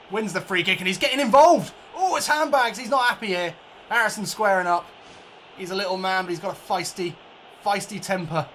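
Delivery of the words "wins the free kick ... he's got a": much higher, louder, rising call of the scuffle; excited commentary. Acted. Gamer commentating a scuffle on the pitch, excited. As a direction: get more wound up as the fight starts.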